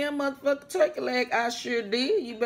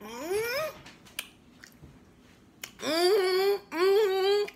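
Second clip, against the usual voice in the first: savoring hum; 10 semitones higher, much slower than usual. Real, not acted. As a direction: hum with relish over the bite. Savoring the food.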